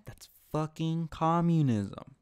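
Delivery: a slow shouted verdict; loud declaration. Real, not acted. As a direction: hammer it home. Rant peaks on communism.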